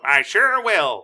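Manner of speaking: slow, loud and smug villain promise; sly villain. Acted. Villain lying to Tintin about freeing the kids, slow and smug. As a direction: drawl it out like a cartoon villain who is lying. Villain voiced in plot retelling.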